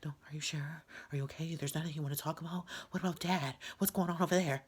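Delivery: imitating the annoying lady who won't let it go; mocking a prying lady. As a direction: needle her like the pushy helper. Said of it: "If I'm saying I'm good".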